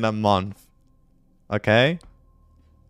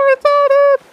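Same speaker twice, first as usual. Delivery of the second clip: sudden squeal far higher and louder; shrieking tease; 7 dB louder than usual. Real, not acted. Teasing a friend on voice chat, high squeal. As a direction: squeal the insult at your friend.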